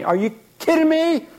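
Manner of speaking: high, incredulous mock outrage; mock indignation. Customer hung up on, mock incredulous outrage. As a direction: play the offended customer. Client upset at customer service.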